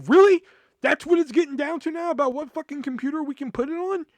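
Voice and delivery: high, loud retold disbelief; exasperated, high-pitched rant; incredulous outburst. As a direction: explode at the petty rule, voice way up. Acted outburst at petty computer rule.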